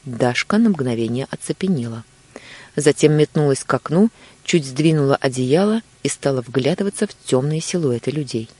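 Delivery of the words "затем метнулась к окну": usual speaking voice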